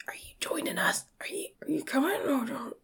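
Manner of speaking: hushed, secretive stage whisper; whispered so only a companion catches it; discreet whisper. Acted. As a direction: whisper the question on the sly. Discreetly asking a companion across room.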